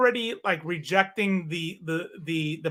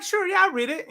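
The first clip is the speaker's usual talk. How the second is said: high, breezy mock reply; polite brush-off; 13 semitones higher than usual. Soldier humoring the host nation guard, high breezy reply. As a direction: toss it off lightly, pitched way up. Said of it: "I'm like".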